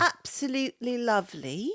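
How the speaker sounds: put-on English lilt on the words; English accent. Co-host slipping into a character's voice while recapping, mock English accent. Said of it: "that's an English accent".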